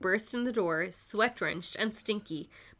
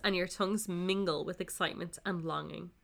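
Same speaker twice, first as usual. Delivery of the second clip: usual speaking voice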